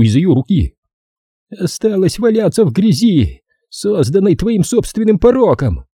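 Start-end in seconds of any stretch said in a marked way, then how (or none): 1.5-5.8 high-pitched, voiced ranting; fevered high-voiced accusation; menacing caller. A narrator's reading. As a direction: denounce her in a raised, ominous voice. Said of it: "горячо шептал мне в ухо".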